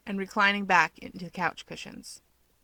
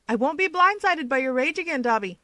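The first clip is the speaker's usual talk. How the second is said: higher, louder vow; firm promise; 6 semitones higher, 5 dB louder than usual. Acted. Keigo promising Toya, raised firm vow. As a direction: promise it steadily. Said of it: "he promises".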